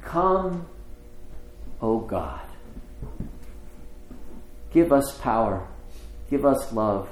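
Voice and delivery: measured prayer in short phrases with long pauses. Real, not acted. Preacher asking God for light and healing, prayerful.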